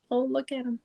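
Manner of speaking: ordinary talk, nothing marked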